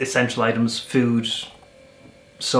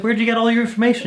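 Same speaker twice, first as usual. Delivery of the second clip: fast, much higher, baffled; amazed question; 10 semitones higher, 4 dB louder, faster than usual. Retold or imitated. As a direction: blurt it out in amazement. Curious question to guard.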